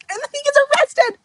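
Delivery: high, fast disbelieving exclamation; real shocked squeal; excited. Real, not acted. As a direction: blurt it out in shock. Excited retelling of plot.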